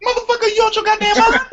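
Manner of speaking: high, mock-outraged movie line; hammed-up Blade quote; imitating Blade. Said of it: "Blade was like".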